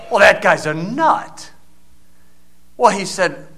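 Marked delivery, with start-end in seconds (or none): 0.1-1.5 dismissive. Retold or imitated. Woman dismisses pastor.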